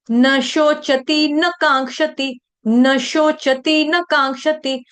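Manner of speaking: "न शोचति, न कांक्षति" chanted slowly with न as a brief nuh; short na. Shown as a demonstration. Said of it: "ना नहीं है, ये न". ("न शोचति, न कांक्षति" में छोटा न)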